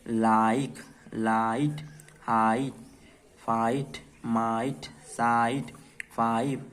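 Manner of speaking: लाइक, लाइट, हाइट each with a stretched "aa-ee"; long I sound. Shown as a demonstration. Teacher reads a word list to beginners, long I in लाइक, माइट, फाइव. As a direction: read singly, gliding "aa" into "ee", as in फाइट. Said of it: "सबको आई के sound में ही पढ़ा है".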